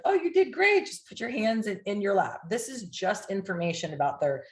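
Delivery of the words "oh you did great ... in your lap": high, upbeat reassurance, softening after the first words; reassuring client. Retold or imitated. Hypnotist reassuring a client after a weak test, reassuring. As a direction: brightly tell them they did great. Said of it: "You're like".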